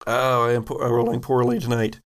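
ordinary delivery

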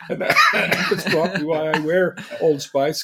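loud, laughing admission; talking through laughter. Real, not acted. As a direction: laugh while you own up to it. Laughing along at the tease.

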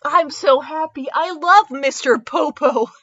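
normal talking voice

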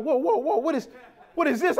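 high shocked "whoa"; high startled cry over fake shoes; alarmed discovery. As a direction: recoil at what you see. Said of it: "And it's like".

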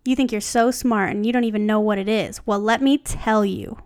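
ordinary delivery

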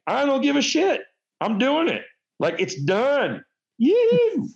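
defiant whoop. Retold or imitated. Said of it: "And I'm just like".

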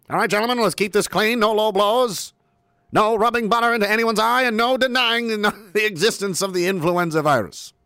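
put-on booming old-timey referee; boxing referee. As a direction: lay down the rules like a fifties ring referee. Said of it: "old-timey radio voice".

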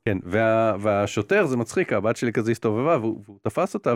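usual speaking voice

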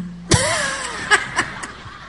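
a high burst of real laughter; laughing. Real, not acted. Laughing at the boys. (risa aguda y espontánea)

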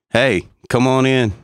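usual speaking voice

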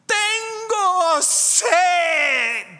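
high, loud, drawn-out scream in Spanish; retold scream. Possessed woman screaming down the hospital corridor, slow high shout. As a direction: shriek it slow like a tormented patient. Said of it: "and I hear someone scream".

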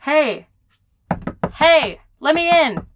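loud, high, urgent yelling; shouting. Acted. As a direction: bang on the glass and yell. Locked out, shouting at window.

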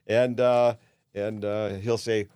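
usual speaking voice

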